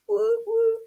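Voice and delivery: two high, level woos; excited whoop. Real, not acted. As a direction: whoop twice, lightly and high. Celebrates the chanted pattern.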